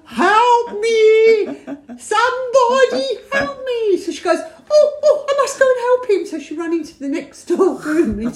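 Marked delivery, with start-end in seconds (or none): 0.1-4.0 high, drawn-out hammed-up wail; mock cry for help. Retelling someone's plea to be rescued, high mock wail. 4.7-6.2 high-pitched fussy imitation; flustered rescuer. Retelling her rush to help him, high mock fluster.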